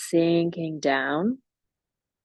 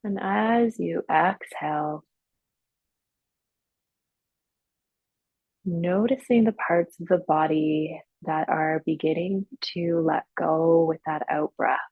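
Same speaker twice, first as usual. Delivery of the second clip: guided meditation. Real, not acted. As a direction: ease them gradually into stillness, leaving long silences. Leads guided breathing and relaxation.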